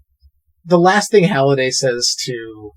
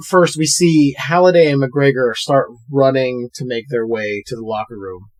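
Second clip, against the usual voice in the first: ordinary talk, nothing marked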